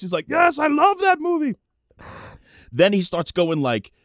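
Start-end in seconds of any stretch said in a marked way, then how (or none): 0.3-1.5 high-pitched shout in imitated Japanese accent; thrilled fan with a Japanese accent; excited Japanese accent. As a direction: gush loudly in a mock Japanese accent. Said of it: "like, accent, can barely speak English".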